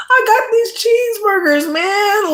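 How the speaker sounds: high, loud mock-begging wail; high wailing mock sob; crying app ad. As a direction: plead like a desperate app. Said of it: "they are crying".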